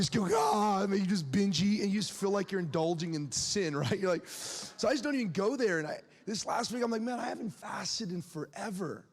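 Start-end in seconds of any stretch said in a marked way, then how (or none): none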